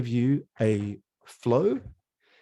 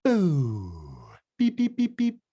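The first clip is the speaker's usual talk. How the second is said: beatbox rhythm; 5 semitones higher, much slower than usual. Shown as a demonstration. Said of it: "give each other a rhythm".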